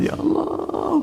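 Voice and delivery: a sighing cry of longing; plaintive cry. Acted. Acts longing for tarawih.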